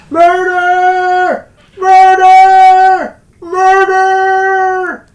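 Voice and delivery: high, drawn-out scream for help. Acted. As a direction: scream it out long and loud, very high. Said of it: "he flees the house screaming".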